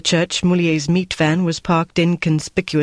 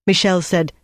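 ordinary delivery, nothing marked

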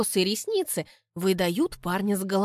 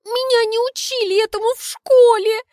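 high, loud sobbed no; sobbing; 13 semitones higher, 8 dB louder than usual. Acted. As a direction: wail it through mock tears. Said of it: "рыдаю я".

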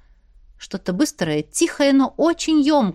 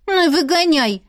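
higher, sulky princess retort; sulky grumble; 6 semitones higher than usual. A narrator's reading. Princess grumbling back at her father, sulky. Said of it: "пробубнила царевна".

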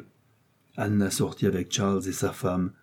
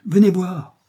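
high, louder call to come; exclaimed summons; 10 semitones higher, 7 dB louder, slower than usual. A narrator's reading. Marie summons Anna to the window, exclaiming. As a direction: cry out urgently to fetch someone. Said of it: "s'écria Marie".

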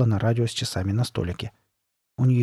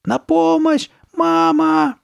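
very high, loud cry for rescue; high, drawn-out shriek of fear; terrified screaming; 6 dB louder, much slower than usual. A narrator's reading. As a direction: scream out like a panicked little boy. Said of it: "От страха он отступил к кровати".

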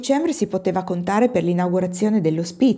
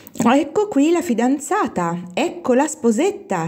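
squeal of announcement; 7 semitones higher, slower than usual. A narrator's reading. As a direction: announce the bride in a high, slow squeal. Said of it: "strillò entrando Anna Doria". (strillo acuto e prolungato)

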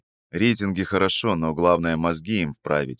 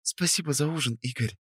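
high, much softer whisper with «Игорь» falling; 8 semitones higher, 9 dB quieter than usual. A narrator's reading. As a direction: whisper it into his ear. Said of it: "шепнула она мне на ухо".

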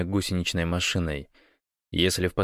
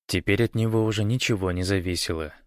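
plain reading voice

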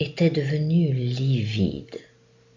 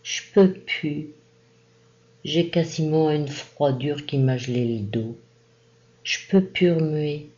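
put-on higher, doleful old man's whine; a deaf old farmer pleading he can't move; plaintive murmur; 4 semitones higher than usual. A narrator's reading. Old father refuses to get up, doleful murmur. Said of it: "murmura d'une voix dolente".

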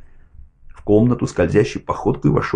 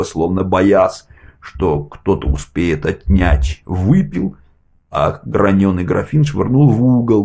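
usual speaking voice

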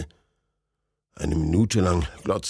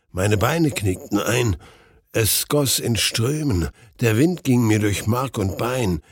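ordinary delivery

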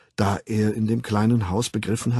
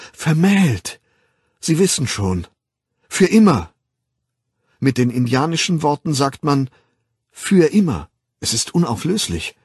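high, loud, emphatic explaining; urgent insistence; 6 semitones higher, 6 dB louder than usual. Acted. Young man asking Bernard about marriage, urgent and emphatic. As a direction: press each phrase home urgently.

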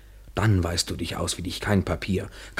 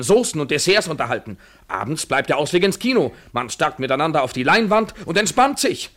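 heated build; 8 semitones higher, 4 dB louder than usual. Acted. Mocking vision of married life.

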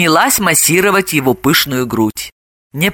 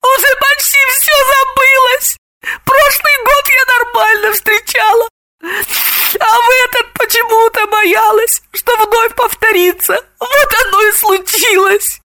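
very high, tearful whine; high-pitched snivelling distress; sniffling. A narrator's reading. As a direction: whimper as if choking on tears. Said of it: "хлюпая носом, сообщила Самохина".

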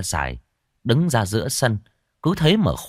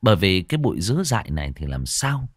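ordinary delivery

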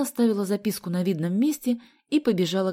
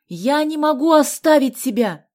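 high, slow, insistent yell; angry shout; 8 semitones higher, 10 dB louder than usual. A narrator's reading. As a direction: shout back crossly. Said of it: "сердито крикнул он".

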